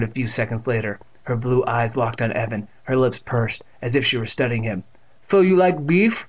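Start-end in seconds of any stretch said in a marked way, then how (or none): 5.3-6.2 high, loud deaf great-aunt's voice; Catherine. A narrator's reading. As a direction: quiz him as the deaf aunt, pitched up. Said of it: "with that distinct pronunciation".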